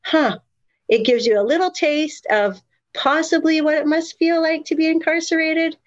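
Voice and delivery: a high, wondering aside; sudden realization. Retold or imitated. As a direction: let the realization lift the voice up high. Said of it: "And I thought".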